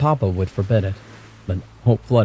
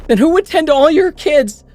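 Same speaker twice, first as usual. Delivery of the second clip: far higher, louder pained shriek; 7 dB louder than usual. A narrator's reading. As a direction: scream it back in pain. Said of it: "I shrieked".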